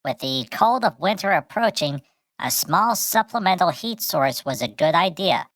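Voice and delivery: usual speaking voice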